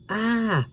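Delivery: high startled shriek; frightened scream. A narrator's reading. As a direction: let out a sharp shriek. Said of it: "Karen screamed".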